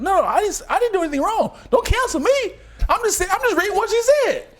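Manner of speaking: very high, frantic mock defense; high-pitched comic protest; Steve Harvey. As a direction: plead innocence in a squeaky panic, hammed up. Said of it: "He trying his best to come".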